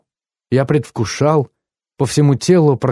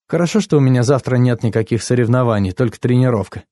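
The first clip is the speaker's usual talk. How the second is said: ordinary delivery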